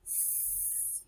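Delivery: "S." as a long unvoiced hiss. Shown as a demonstration. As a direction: hiss "S." through teeth like a snake. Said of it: "Put your teeth together".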